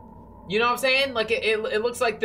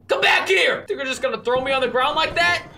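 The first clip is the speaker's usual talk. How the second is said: indignant shout; 8 semitones higher, 8 dB louder than usual. Pretend. Player to runaway who threw them down, indignant shout. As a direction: call out loudly, high-pitched and affronted. Shouts after fleeing game character.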